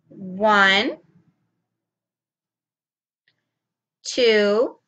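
slow counting with long pauses while signing; teacher counts while signing. Shown as a demonstration. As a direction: count slowly, leaving time to sign. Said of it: "I'm going to sign this".

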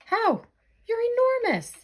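high, loud startled yelps; startled shock. Real, not acted. Seeing Narwhal suddenly enormous, high startled shock. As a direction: jump at the sight.

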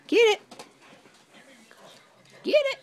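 high-pitched, quick bursts to a dog; excited egging-on. Real, not acted. Owner urging dog to get toy, excited.

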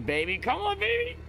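normal talking voice

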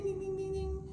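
imitated phone chime, very high and ringing; ding like a finished update; high chiming dings. Shown as a demonstration. Choir director mimicking a phone update finishing, high chime.

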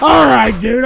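usual speaking voice

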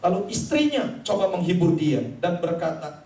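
usual speaking voice

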